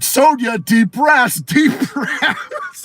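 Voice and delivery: high-pitched mock therapist coaching; imitated therapist, breaking into laughter. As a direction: laughingly urge someone to breathe. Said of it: "the fucking therapist was there".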